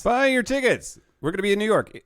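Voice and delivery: very high mock-excited echo; hammy high-pitched plug. Co-host echoing the ticket plug, silly high voice. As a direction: squeal the plug in playful falsetto.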